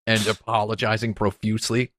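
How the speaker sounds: usual speaking voice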